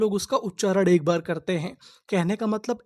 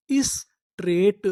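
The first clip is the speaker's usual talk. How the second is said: drawn out slowly; slow drawn word; much slower than usual. Shown as a demonstration. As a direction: stretch straight slowly for learners. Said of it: "तो इतनी meaning क्या बनी?". (धीरे खींचकर बोलना)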